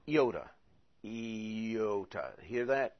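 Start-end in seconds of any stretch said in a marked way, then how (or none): 0.1-0.5 iota with a very quick little I, yota. Shown as a demonstration. Teacher demonstrates the quick Greek iota. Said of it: "a very quick little I". 1.0-2.3 iota slowed, ee-OH-tuh. Shown as a demonstration. Teacher slows iota down for students. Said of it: "I slowed it down".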